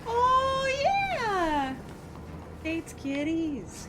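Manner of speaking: high, drawn-out squeal of delight; gushing coo. Real, not acted. Hearing of a viewer's three cats, gushing coo. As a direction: coo over someone's cats, stretched and high.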